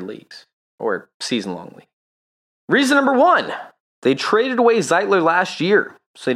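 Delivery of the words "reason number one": hyped announcement. Real, not acted. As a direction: announce it like a countdown. Announcing the list.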